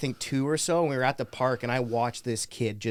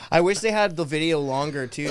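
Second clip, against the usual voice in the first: ordinary talk, nothing marked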